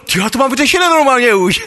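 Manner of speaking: high, excited report of good news; amazed relief. Retold or imitated. The boy coming back, excited his problem is solved. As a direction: tell it like a small miracle.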